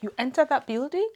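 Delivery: a stunned echo of the question; retold amazement, rising sharply; astonished question.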